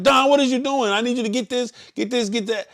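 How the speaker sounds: high, loud barking of orders; fussing demo. Shown as a demonstration. Leader showing Dawn how he fusses on the road, high and loud. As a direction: show her how you fuss at riders. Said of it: "And when I say fussing, I mean, like".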